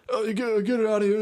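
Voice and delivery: high-pitched mock fussing; high, rambling mock protest; imitating panicked man. Retells upset man at dog fight.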